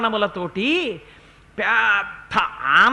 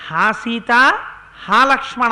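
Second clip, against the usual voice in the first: louder desperate call of Ha Sita, Ha Lakshmana; loud cry; 7 dB louder than usual. Retold or imitated. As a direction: shout the names out in a retold cry. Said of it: "అని తన కంఠంతో అరిచాడు".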